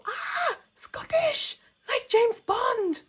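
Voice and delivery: high breathy gasp of delight, then slow and loud; excited foreigner. Retold or imitated. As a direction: gush like a thrilled tourist meeting a Scot. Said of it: "they go".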